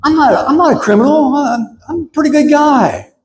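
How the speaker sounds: a hint of David's earnest voice; friend's retold words. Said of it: "He said".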